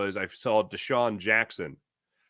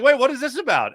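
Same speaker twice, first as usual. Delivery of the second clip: surprised; 13 semitones higher, 7 dB louder than usual. Retold or imitated. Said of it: "So I was like".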